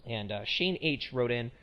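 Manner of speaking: usual speaking voice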